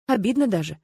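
usual speaking voice

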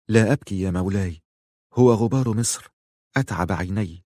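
usual speaking voice

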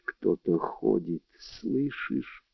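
very soft, hushed warning; whispered. A narrator's reading. Netta hears someone walking about, whispering to Steve. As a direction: drop right down so no intruder hears. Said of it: "шепнула она".

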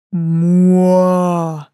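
drawn-out trumpeting moo; bull bellow. A narrator's reading. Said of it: "вторично протрубил мой спаситель". (трубное мычание быка)

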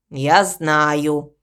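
low, glum crow; gloomy. A narrator's reading. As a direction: admit it glumly, voice dropped low. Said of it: "Молчаливый и мрачный". (мрачно, низким голосом)

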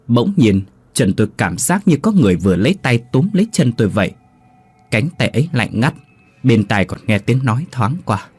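plain reading voice

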